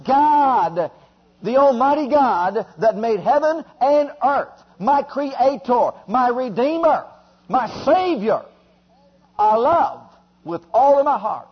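loud, rising prayer at full force; fervent preaching. Acted. Preacher modeling a prayer for the church, fervent and loud. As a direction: pray it out with mounting fire.

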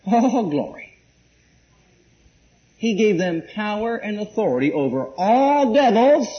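ordinary talk, nothing marked

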